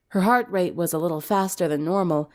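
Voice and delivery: ordinary delivery, nothing marked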